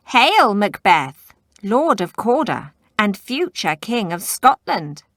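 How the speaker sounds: loud, high cackling witch voice; cackling hags. Acted. The witches cackle their prophecy at Macbeth, loud hag voices. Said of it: "the hags cackled".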